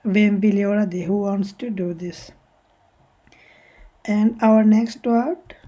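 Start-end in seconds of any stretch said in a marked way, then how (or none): none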